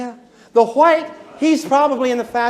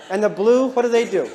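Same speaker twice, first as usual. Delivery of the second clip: usual speaking voice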